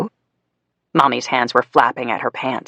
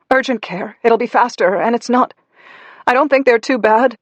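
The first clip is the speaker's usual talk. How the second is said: higher, strained mother's voice; pained Mommy; 8 semitones higher than usual. Acted. Burned Mommy showing Daddy her burns, higher strained. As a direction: speak through the pain. Mommy argues while hurt.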